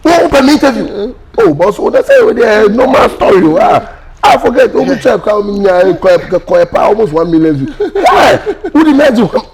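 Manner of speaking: high, loud and worked up; excited. Real, not acted. Telling a friend about his viral video, worked up and loud.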